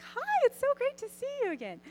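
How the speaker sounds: high sing-song welcome; mock sweet greeting. Mom greets her daughter who came back, high sing-song mock delight. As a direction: gush it like nothing happened. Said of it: "It was really the funniest thing".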